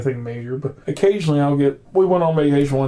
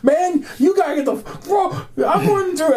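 shouting; 13 semitones higher than usual. Retold or imitated. Retold fire chaos shouting.

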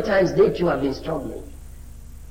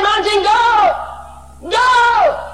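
high, loud, slow command at the mountain; ringing shout of faith; 7 dB louder, much slower than usual. Acted. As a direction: order the mountain to go.

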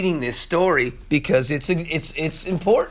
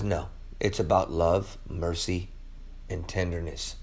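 much lower and softer, tender; Jesus speaking gently; 12 semitones lower, 12 dB quieter than usual. Acted. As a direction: hush it down like Jesus comforting. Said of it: "Jesus says".

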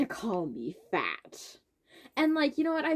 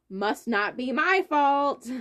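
loud, slow, raised mock innocence; 4 semitones higher, 6 dB louder, slower than usual. Girl mimicking the ex who takes no blame, mocking. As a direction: mimic his self-excusing logic, stretched out and louder. Mocks what the ex thought.

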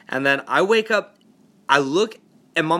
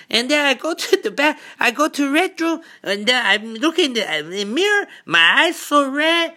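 imitating his mom; 8 semitones higher than usual. Mom describing the smell in her room, high accented worry. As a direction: ham up a rattled mom telling her night. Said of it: "She's like".

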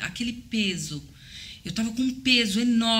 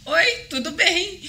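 husband's voice, mocking; 8 semitones higher, 4 dB louder than usual.

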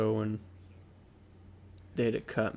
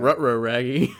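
Scooby-Doo voice; 6 dB louder than usual. Acted.

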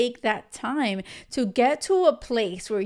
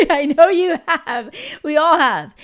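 a loud, higher laughing repeat, the words bursting out; laughing loudly; 5 semitones higher, 8 dB louder than usual. Real, not acted. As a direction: laugh it out big and knowing.

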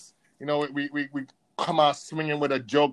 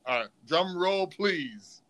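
ordinary talk, nothing marked

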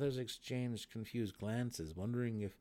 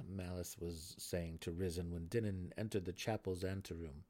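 ordinary delivery, nothing marked

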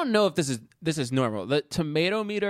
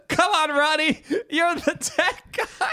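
high, drawn-out mock cheer; mock coaxing; 10 semitones higher than usual. Friends egging Ronnie on at the film's end, mock coaxing. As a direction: egg him on in a high, stretched voice.

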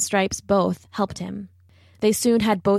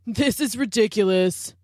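spat out; 2 semitones higher than usual. A narrator's reading. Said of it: "spat a cheetah".

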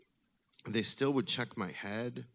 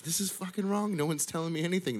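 high, louder indignant rant; outraged outburst; 11 semitones higher, 6 dB louder than usual. Retold or imitated. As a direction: burst out, fed up with being ignored. Kid outraged at being shushed.

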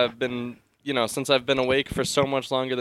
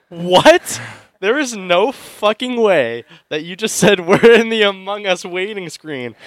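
loud, higher, disbelieving yelp; incredulous outburst; 6 semitones higher, 7 dB louder than usual. Retold or imitated. To his sleep-talking girlfriend, a loud incredulous yelp. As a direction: blurt it out in total disbelief.

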